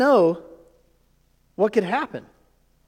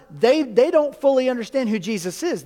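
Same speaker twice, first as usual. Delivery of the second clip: ordinary talk, nothing marked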